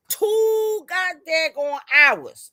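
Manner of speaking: two goddamn hours stretched out slow and high; exasperated emphasis. Real, not acted. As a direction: drag out every word in disbelief. Venting about long argument.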